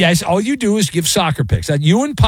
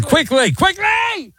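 sharp high call to hurry up; high-pitched yell of quickly; urgent shout; 13 semitones higher, much slower than usual. Real, not acted. Host urging caller, shout heard.

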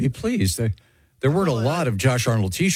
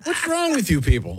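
higher, indignant protest; mock exasperation; 8 semitones higher than usual. Real, not acted. Letter reader scolds snickering co-hosts, exasperated. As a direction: scold the room, pitched way up.

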